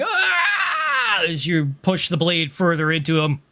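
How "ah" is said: high-pitched scream held back in volume; mock scream. Game master voices the man being cut by the blade, mock scream. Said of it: "He's screaming".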